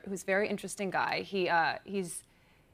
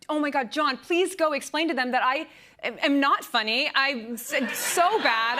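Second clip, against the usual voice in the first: higher, louder mock-panicked pleading; embarrassed plea, pitched up; retold frantic plea; 6 semitones higher, 4 dB louder than usual. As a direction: beg him to fix it, half-joking. Retold plea to husband John.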